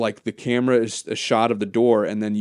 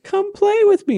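high sing-song doll voice; eerie high-pitched doll invitation; creepy doll; 5 dB louder than usual. Acted. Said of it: "she does the thing of".

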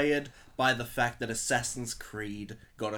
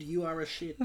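deadpan quote; 3 semitones higher, 3 dB quieter than usual. Retold or imitated. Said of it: "the guy who said".

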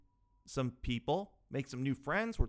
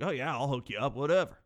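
put-on drunk bro. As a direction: brag like a tipsy big shot. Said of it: "I was drunk enough where I was like".

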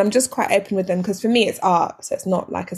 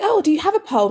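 mock curious date; 8 semitones higher, faster than usual. Imitating date's question.

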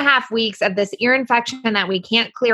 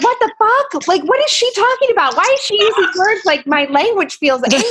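stunned inner outburst; 11 semitones higher than usual. Retold or imitated. Mom reacting inwardly to her daughter's criticism, shocked and indignant. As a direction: play your own shocked inner voice, half laughing.

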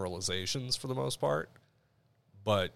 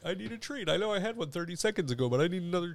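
higher, begging puppy voice; dog voice; 9 semitones higher than usual. Acted. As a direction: whine for a treat like an eager dog. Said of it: "I'll talk in the dog's voice".

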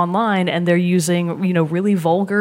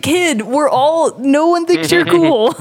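exasperated retort; 7 semitones higher, 4 dB louder, slower than usual. Retold or imitated. Retorting to rude kid.

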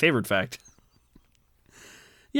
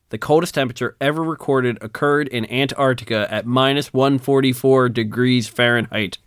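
ordinary talk, nothing marked

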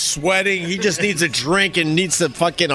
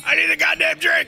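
much higher, exasperated cry; exasperated outburst; 12 semitones higher than usual. Retold or imitated. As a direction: let it burst out in high desperation. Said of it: "It's like".

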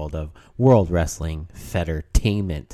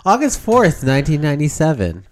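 loud, high hyped date call; announcer shout; 7 semitones higher, 5 dB louder than usual. Acted. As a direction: belt the date like a ring announcer.

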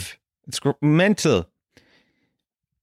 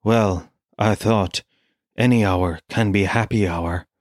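lower, deadpan mock Depp; Johnny Depp impression; 6 semitones lower than usual. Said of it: "And Johnny Depp goes".

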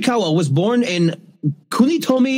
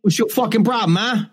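mock New Jersey tough guy, a bit higher; lightly put-on New Jersey accent, picking a fight; 3 semitones higher than usual. Asked for a New Jersey accent, a lightly done Jersey accent. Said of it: "Can you do a New Jersey accent?".

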